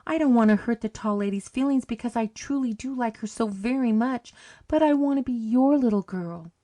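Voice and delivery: high, urgent begging; girl clinging to the Pretty Lady, pleading. Acted. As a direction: keep pleading, near tears. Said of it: "she begged".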